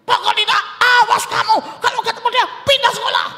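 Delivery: high, loud furious threat; shouting parent laying down the law; angry parent. Acted. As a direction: forbid it outright and threaten the child. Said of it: "Kalau Anda mulai dengan marah-marah".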